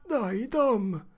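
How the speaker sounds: put-on high pressing boy's plea; urgent plea. Huck urges Tom, high and frightened. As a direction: push him to look.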